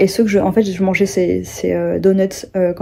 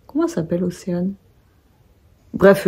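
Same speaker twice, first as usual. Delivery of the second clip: normal talking voice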